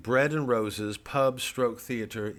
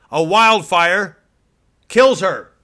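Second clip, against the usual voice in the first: very loud, slow, higher, overwrought; melodramatic; 8 semitones higher, 16 dB louder, much slower than usual. Acted. Pundit's fake news story climax, loud and melodramatic. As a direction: boom it like a horror trailer.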